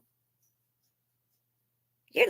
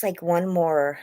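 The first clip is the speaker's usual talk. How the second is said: normal talking voice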